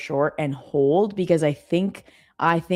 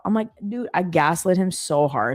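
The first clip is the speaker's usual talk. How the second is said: ordinary delivery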